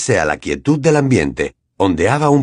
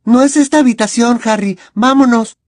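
put-on higher, louder girl's voice, a touch anxious; frightened Hermione; 13 semitones higher, 5 dB louder than usual. A narrator's reading. Hermione begging Harry to leave, mildly scared. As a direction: urge him out, worried. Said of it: "Hermione parecía asustada".

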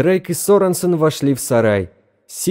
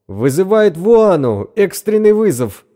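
urgent radio call; 8 semitones higher, 4 dB louder than usual. A narrator's reading.

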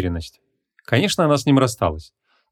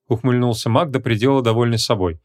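ordinary delivery

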